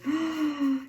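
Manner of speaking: high, sharp intake of surprise; delighted gasp. Real, not acted. Delighted by gift items.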